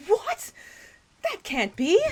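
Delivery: high, loud cry of disbelief; shocked disbelief. Acted. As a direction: refuse the news like a stage heroine. Wife told it was suicide.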